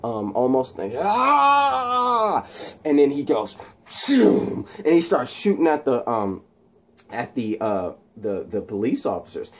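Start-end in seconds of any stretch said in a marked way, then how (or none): 1.0-2.5 long, high mock scream; imitated scream. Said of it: "he's screaming". 3.9-4.6 high, whooshing zap noise; imitated electric blast.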